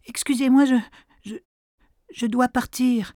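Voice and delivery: a scared fish trying to leave; nervous stammer. Acted. (bégaiement effrayé)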